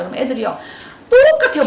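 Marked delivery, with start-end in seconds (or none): 1.1-1.6 squeaky high burst of emphasis; high exclamation. Real, not acted. Mom on kids, high loud exclaim.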